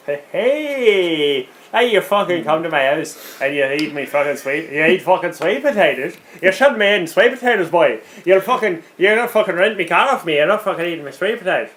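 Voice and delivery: rowdy fake Irish accent. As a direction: ham up a bad Irish accent. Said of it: "your attempted Irish accent".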